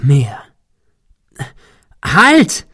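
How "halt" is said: high, loud cry of alarm; shrill scream. A narrator's reading. Man warning his friend as the dish moves, screaming shrilly. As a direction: scream it shrilly to stop him. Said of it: "schrie er schrill".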